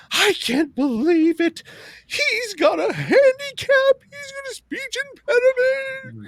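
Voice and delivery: very high, slow, sobbing mockery of her; mock wailing woman. Mimicking the babysitter defending Biden, hammed-up wailing. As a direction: wail it like an overwrought crier. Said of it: "she just lost it".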